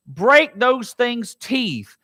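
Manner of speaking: very slow, drawn out; slow plea. Acted. Preacher as David asking God, slow. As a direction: drag out each word.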